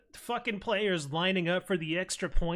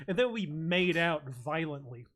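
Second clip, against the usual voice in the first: ordinary delivery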